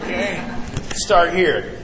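usual speaking voice

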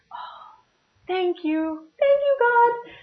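high, hushed sigh of thanks; acted relief. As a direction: breathe out, then squeal a little thanks to heaven. Silent prayer of relief on phone call.

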